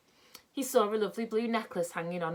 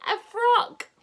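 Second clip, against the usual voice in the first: much higher and louder exclaim; shouted correction; 8 dB louder than usual. Acted. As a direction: shout it so a deaf man hears. Beth repeats for deaf Saucepan.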